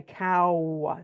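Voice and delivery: "cow" stretched slowly through the ow glide; drawn-out diphthong. Shown as a demonstration. Teacher showing two sounds in a glide, "cow" drawn out. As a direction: say "cow" long, sliding from ah to oo. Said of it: "there's a glide between two sounds".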